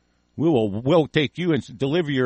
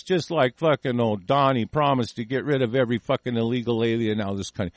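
ordinary talk, nothing marked

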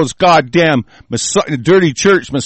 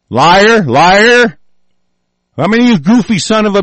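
shouting; 5 semitones higher, 4 dB louder than usual. Real, not acted. Shouting accusations at politicians.

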